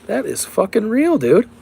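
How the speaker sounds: stunned high-pitched shout of amazement; amazed exclamation. Retold or imitated. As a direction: yelp it like you just saw proof. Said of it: "And I said".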